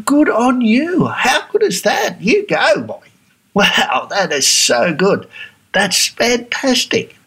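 high, slow, beaming over-the-top praise; gushing praise. Retold or imitated. Americans hearing the business is booming, gushing praise. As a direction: lay the praise on thick and delighted. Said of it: "they'll be going".

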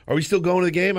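put-on high, eager little-boy question; light imitation of an excited son; eager kid. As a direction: ask like a hopeful child.

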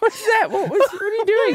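baffled by the buzz; incredulous. Real, not acted.

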